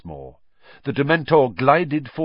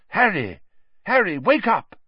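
high, louder call to wake him; worried teacher calling a boy awake; urgent calling; 10 semitones higher, 5 dB louder than usual. A narrator's reading. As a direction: shake him awake with your voice. Lupin waking Harry urgently.

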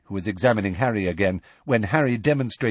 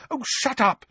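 much higher, snapping; angry. A narrator's reading. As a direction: snap it angrily. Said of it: "said Hermione angrily".

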